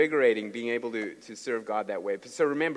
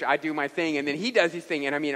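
usual speaking voice

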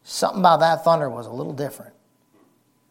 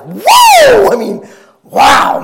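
awed shout; 15 dB louder, much slower than usual. Real, not acted. Preacher overwhelmed by awe.

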